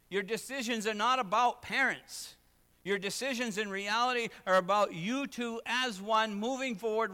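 loud, high, emphatic preaching; full-force preaching. Acted. Pastor urging young couples, preaching loud and high. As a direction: drive each point home to the pews.

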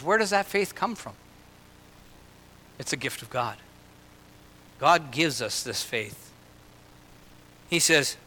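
normal talking voice

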